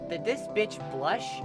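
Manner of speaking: ordinary delivery, nothing marked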